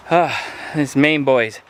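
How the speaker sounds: ordinary delivery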